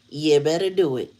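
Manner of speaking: ordinary talk, nothing marked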